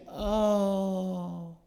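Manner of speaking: hammed-up self-pitying moan of a sulking king; mock lament. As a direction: lament with mild, put-on misery. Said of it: "He was sitting there lamenting".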